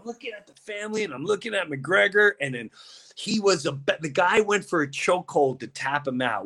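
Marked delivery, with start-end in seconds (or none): none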